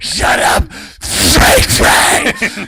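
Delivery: loud, strained, voiceless screech; mock enraged yell. Little girl turning on him, hammed-up screamed retort. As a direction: imitate a kid screaming herself hoarse. Girl's retold enraged outburst.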